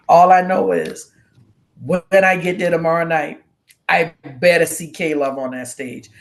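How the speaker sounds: loud, imitated no-nonsense declaration; mock stern insistence; serious tone. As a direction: retell it loud and emphatic, laying down the law. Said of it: "You've never used that tone with me before".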